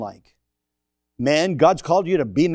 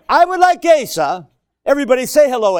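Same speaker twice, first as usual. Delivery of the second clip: loud announcement; 9 semitones higher, 8 dB louder than usual. Real, not acted. Announcing Asa to church.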